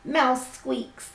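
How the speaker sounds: slow, lilting storybook voice; reading to children. A narrator's reading. Teacher reading a picture book to her class, gently storytelling. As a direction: read it like bedtime story time.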